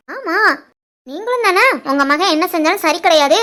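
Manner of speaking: ordinary delivery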